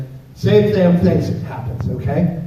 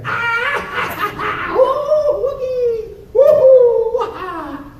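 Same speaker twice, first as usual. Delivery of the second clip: put-on squealing, laughing kids' shriek; high giggling squeal of little kids; shrieking laughter; 3 dB louder, much slower than usual.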